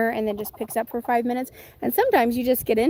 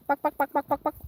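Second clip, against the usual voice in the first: light imitation of a hen calling; mock chicken clucking; 3 semitones higher, faster than usual. Calling her chickens with a playful mock cluck.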